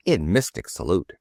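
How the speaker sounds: usual speaking voice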